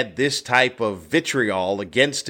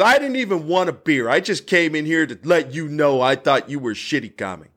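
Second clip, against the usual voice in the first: mock aggrieved heckler, pitch raised; retold rant; 5 semitones higher than usual. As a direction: imitate an indignant drunk going off. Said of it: "he just keeps going off".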